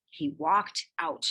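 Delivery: ordinary delivery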